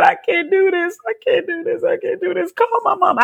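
high-pitched, tearful desperate pleading; crying plea. Retold or imitated. Patient overwhelmed in hospital begging for mama, crying plea. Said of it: "I burst out crying".

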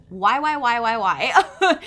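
loud, rapid-fire self-questioning; insistent inner critic. Acted. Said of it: "critical thinker in me is immediately like".

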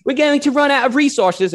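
put-on loud, high, muddled Australian-or-South African accent; botched British-panelist accent. Host repeating the British panelists' worry, in a loud, high mock accent. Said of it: "I have no idea if that was Australian or South African or what".

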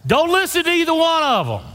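way up high and loud, warning them off; high exclaiming plea. Real, not acted. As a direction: cry out don't listen.

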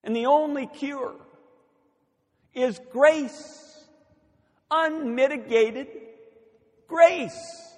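high, forceful sermon delivery; impassioned preaching. Real, not acted. Preacher urging listeners to see people with grace, impassioned. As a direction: drive the point home to the congregation.

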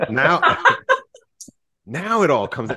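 high, loud burst of laughter through the words; real amused laughter, voice jumping up; laughing. Real, not acted. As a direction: talk while cracking up. Laughing at the impression.

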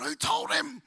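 sudden high alarmed cry; caught-out yelp of surprise; startled. Retold or imitated. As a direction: blurt it out as if caught. Startled thought during sermon.

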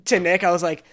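ordinary talk

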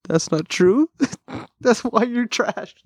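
high, slow teasing put-on voice; mock inner voice. Thinking it privately about the DJ, in a high mocking voice. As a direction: squeak out the insult slowly, half joking. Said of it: "I didn't say that to him, but in my head".

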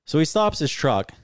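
ordinary delivery

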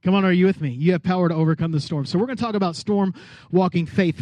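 ordinary talk, nothing marked

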